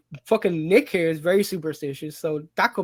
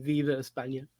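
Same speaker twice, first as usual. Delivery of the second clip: soft, low Viva España, half under breath; quiet Viva España; 4 semitones lower, 8 dB quieter than usual. Shown as a demonstration. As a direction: murmur Viva España as a sign-off. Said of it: "end at me saying".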